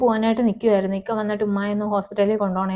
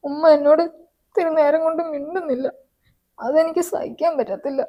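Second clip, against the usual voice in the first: tearful pleading; 8 semitones higher than usual. Acted. Pleading that mother won't speak.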